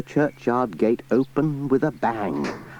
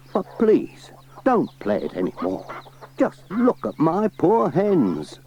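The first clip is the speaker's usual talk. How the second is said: pleading farmer; 8 semitones higher than usual. Acted. Alf pleading.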